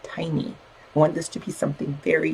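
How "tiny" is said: "tiny" set apart after a pause, said softly; pause, then a small, soft "tiny"; single out "tiny" by dropping the volume; stress on tiny. Real, not acted. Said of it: "I want to emphasize the word".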